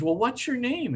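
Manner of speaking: put-on high childlike voice asking her name; little boy asking. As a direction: ask it like a curious small boy. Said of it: "And he says".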